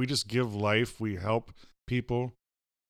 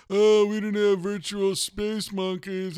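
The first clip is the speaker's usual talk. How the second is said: mock future person voice; 10 semitones higher, slower than usual. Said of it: "they're gonna say".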